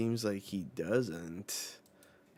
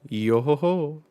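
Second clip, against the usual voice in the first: higher, louder put-on chuckle; weird laugh; 6 semitones higher, 9 dB louder than usual. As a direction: tack the jolly laugh onto the end. Brook's signature laugh voiced.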